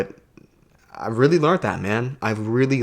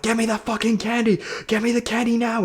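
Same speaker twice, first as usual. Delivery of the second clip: high-pitched tantrum, not much louder; mock child screaming; 12 semitones higher than usual. Mind as a child demanding candy, high mock scream. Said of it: "it starts screaming louder".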